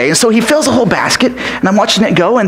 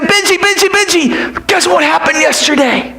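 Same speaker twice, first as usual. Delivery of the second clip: higher, eager calling out; excited calling; 7 semitones higher than usual. Acted. Boy calling friend Benji, excited. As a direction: call his friend over, bursting with news.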